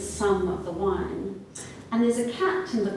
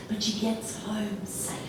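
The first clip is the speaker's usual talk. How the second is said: usual speaking voice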